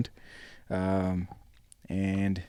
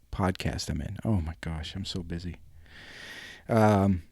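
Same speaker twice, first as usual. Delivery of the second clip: usual speaking voice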